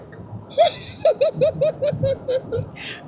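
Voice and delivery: long high-pitched giggle; high giggle fit. Real, not acted. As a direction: burst into a helpless high giggle. Giggling at failing the word.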